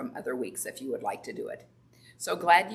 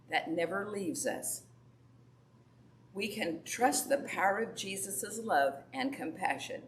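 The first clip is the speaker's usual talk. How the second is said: prayer; 2 semitones higher, 4 dB quieter than usual. Acted. Leads a prayer.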